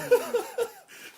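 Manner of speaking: high loud laugh; burst of laughter. Real, not acted. Laughing at the silly voice, loud. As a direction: crack up. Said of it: "laughs at the voice".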